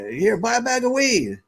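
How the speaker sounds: high, insistent street pitch; pushy hustler. Retold or imitated. Beach dealer pushing weed on a tourist, high. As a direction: keep pushing the sale.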